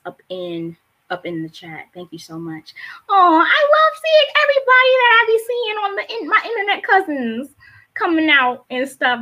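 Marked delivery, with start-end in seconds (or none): 3.1-9.2 high, loud squeal of affection; gushing delight. Real, not acted. Poet greeting internet cousins in the chat, gushing delight.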